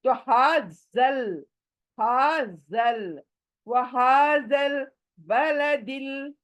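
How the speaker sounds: slow हाज़ल, mouth wide open; open-mouthed recitation. Shown as a demonstration. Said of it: "मुंह खोलो".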